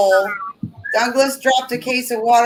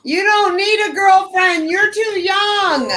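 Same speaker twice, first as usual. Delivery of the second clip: mock wail; 8 semitones higher than usual. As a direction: wail it like a heartbroken mom. Mother teasing son about girlfriend.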